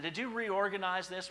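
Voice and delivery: high, slow pointed question; asking again. Retold or imitated. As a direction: press her with the question. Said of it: "I said".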